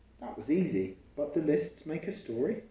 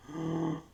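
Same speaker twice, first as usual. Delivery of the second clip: gasp; 5 semitones higher than usual. Acted. Reading aloud, end of story.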